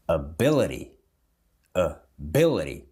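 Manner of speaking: "Ability" as "uh-BIL-uh-dee", T quickly tapped; flapped T. Shown as a demonstration. Teacher showing flapping, "Ability" with a tapped T. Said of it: "Mas é o flapping".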